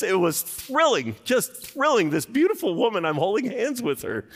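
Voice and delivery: high, loud, excited gushing; thrilled. Real, not acted. Pastor telling congregation about dating Carol, high excited gushing. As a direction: gush about it still thrilled. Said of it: "reliving the thrill".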